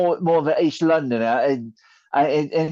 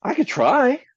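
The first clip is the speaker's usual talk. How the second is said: silly voice; 8 semitones higher than usual. Acted. Silly joke voice.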